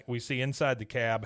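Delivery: ordinary talk, nothing marked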